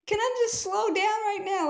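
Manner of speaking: high-pitched mock plea; overwhelmed pleading. Speaker acts out someone with too much going on, pleading. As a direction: plead for a break, voice climbing. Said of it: "you're just like".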